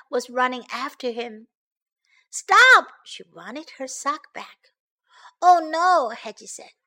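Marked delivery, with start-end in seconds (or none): 2.3-2.9 high, loud call to halt; shouting. Acted. Girl chasing hedgehog for her sock, shouting. 5.4-6.1 high, loud cry of dismay; acted alarm, pitched up. Hedgehog sees the girl laughing, dismayed.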